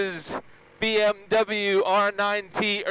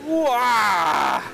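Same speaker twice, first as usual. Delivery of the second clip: surprised shout; 4 semitones higher than usual. Real, not acted. Sudden burst of acceleration.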